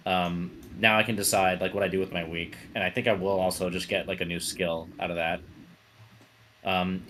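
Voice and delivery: normal talking voice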